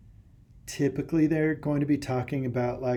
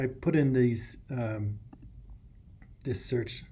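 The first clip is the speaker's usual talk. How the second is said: ordinary talk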